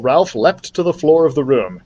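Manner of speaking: ordinary delivery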